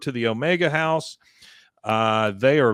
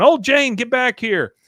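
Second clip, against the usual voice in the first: high loud leader call; 11 semitones higher, 7 dB louder than usual. Retold or imitated. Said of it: "cult leader's in the back going".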